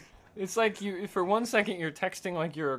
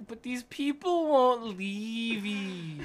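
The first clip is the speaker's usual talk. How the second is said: put-on whiny girlfriend; 6 semitones higher, much slower than usual. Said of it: "And then you're like".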